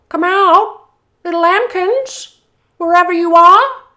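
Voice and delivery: high, loud sing-song call. Acted. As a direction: coax the lambs out from far away. Wolf calls for hidden lambs.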